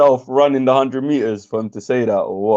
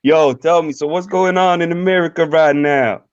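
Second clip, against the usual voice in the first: attempted American accent, higher pitched; 4 semitones higher than usual. British streamer imitating his American friend, American accent. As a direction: put on a rough American accent. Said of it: "Hey, was that close?".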